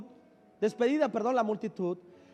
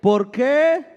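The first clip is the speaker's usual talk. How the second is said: loud, slow preaching shout; preaching at full force; 2 semitones higher, 10 dB louder than usual. Real, not acted. Preacher drives home the verse to the church, loud and slow. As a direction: thunder it out to the congregation. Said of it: "diga conmigo".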